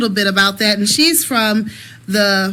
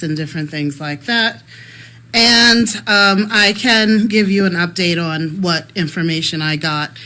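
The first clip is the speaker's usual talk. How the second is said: ordinary talk, nothing marked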